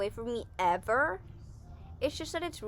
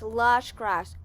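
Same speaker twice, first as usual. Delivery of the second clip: ordinary delivery, nothing marked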